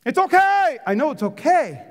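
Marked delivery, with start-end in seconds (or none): 0.1-0.8 mock congregant. Preacher voicing a congregant.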